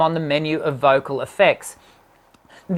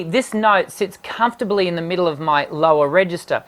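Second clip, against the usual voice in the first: normal talking voice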